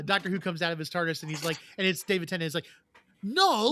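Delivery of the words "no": bad British accent, protesting loudly; terrible British accent. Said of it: "That was my terrible British accent".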